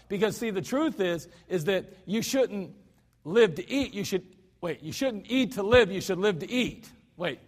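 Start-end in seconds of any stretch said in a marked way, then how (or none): none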